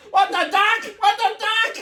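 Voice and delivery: high, loud words through laughter; laughing. Real, not acted. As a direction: repeat it while cracking up. Laughing at the photos.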